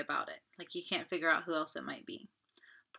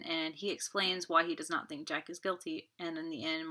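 ordinary delivery

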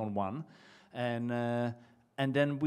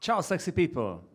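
playful, higher-pitched Italian accent; 5 semitones higher, 4 dB louder than usual. Shown as a demonstration. Bartender jokingly greets the audience in his promised Italian accent. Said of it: "This is the Italian accent".

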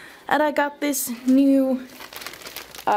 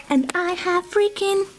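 ordinary delivery

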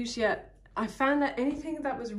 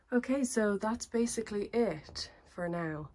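ordinary talk, nothing marked

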